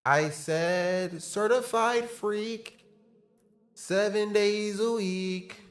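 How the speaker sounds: slow, high, warped autotune singing; broken auto-tune. Real, not acted. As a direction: sing it slow through glitchy autotune. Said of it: "I broke the auto-tune".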